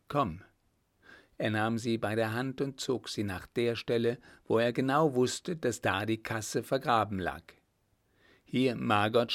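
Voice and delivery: usual speaking voice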